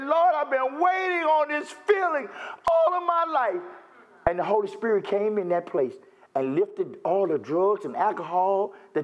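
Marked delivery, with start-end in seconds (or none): 0.0-3.6 crying out to the Lord. Retold or imitated. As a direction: pour it out like a breaking prayer. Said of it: "I started crying".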